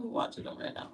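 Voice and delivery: hushed, breathy throwaway whisper; whispered aside. Acted. Comic tells the crowd about the kids in a whisper. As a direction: lean in and whisper it like a secret.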